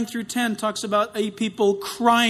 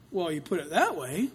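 ordinary talk, nothing marked

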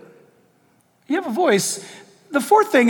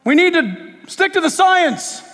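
slow, raised-voice imitation of a crowd; half-yelled, drawn-out slogan; mock yelling; 6 semitones higher, 3 dB louder than usual. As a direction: proclaim it like a mock demand. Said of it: "everybody yelled".

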